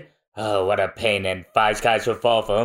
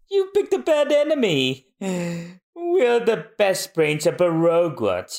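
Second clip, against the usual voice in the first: haughty villain boast; 5 semitones higher than usual. Acted.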